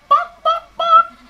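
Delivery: loud, high hen's bok bok; clucking hen. Shown as a demonstration. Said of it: "they say cluck".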